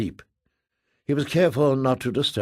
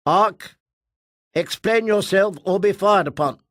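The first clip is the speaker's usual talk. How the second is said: higher, louder, commanding; threatening military commander; 6 semitones higher, 3 dB louder than usual. Acted. Guardian Kafira warning the Ark, threatening. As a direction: warn them you will open fire.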